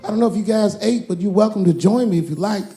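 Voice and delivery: high, fast, excited host voice; Kevin Hart impression. Kevin welcoming Dave to dinner, high and hyped. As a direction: chirp it up like a hyped little host. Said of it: "Kevin came around the corner".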